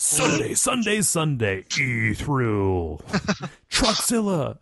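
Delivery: booming rally ad voice; monster truck announcer. Pretend. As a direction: sell the Sunday truck show. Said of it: "Monster Truck voice".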